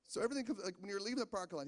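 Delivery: usual speaking voice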